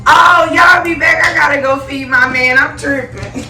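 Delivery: high, loud tipsy goodbye. Real, not acted.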